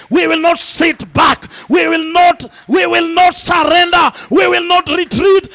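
rally the crowd at full roar; roaring rallying speech. Acted. Preacher builds to shouted rally.

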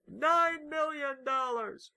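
high, slow triumphant bid; triumphant shout. Pretend. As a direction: call the huge bid out, drawn out. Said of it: "they're like".

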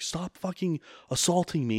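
a retold angry outburst, not shouted; retold angry demand. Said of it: "pretty aggressive".

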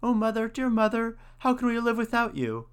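high, tiny chick voices crying out; small chicks pleading for their mother. A narrator's reading. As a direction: cry out like frightened little chicks. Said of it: "ran under the hen's wings and cried".

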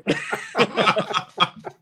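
high, bursting laugh at his own punchline; hearty laugh. Real, not acted. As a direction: laugh it out as the story lands. Laughing at own wrestling story.

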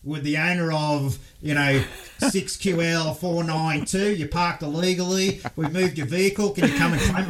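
a hint of a public address voice; loudspeaker announcement. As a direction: read it like a crackly airshow PA. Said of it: "on the loudspeakers going".

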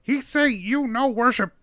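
high kobold voice in broken speech; halting little kobold; translating kobold. Acted. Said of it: "He says".

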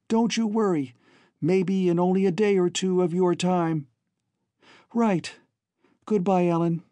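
high-pitched, earnest farewell exchange; anxious parting siblings. Acted. Voices siblings' hurried farewell.

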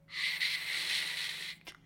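whispered hiss; snake hiss. Acted. The snakes hush Habuli, sharp snake hiss. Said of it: "sisten de slangen".

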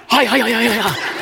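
rapid high-pitched mock karate chops; kung fu cries. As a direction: imitate martial-arts chopping cries. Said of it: "he was doing kung fu on me".

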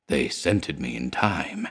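low, soft, slow moan; a lost soul groaning out the words. A narrator's reading. Broken man moans to his friend, low, soft and slow. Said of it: "he moaned".

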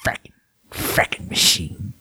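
low, slow gravelly growl; Adama impression. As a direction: growl it out like an old commander. Imitating Adama lines.

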